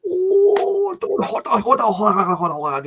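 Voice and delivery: put-on strained, caricatured Japanese accent starting high and falling; exaggerated Japanese impression, as if constipated; put-on Japanese accent, high opening wail; mock Japanese accent. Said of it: "constipation and chilblains to speak Japanese".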